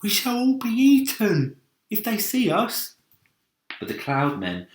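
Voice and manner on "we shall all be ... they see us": nervous earthworm. Acted. Earthworm voiced in story.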